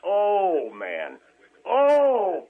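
loud, high outraged cry; outraged outburst. Real, not acted. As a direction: burst out in disbelief. Caller erupting in outrage.

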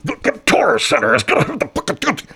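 high, booming ad-spot announcer. Retold or imitated. Imitating the ad guy Kevin's announcer read. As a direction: sell it like a radio spot. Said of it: "It didn't sound like anything like him".

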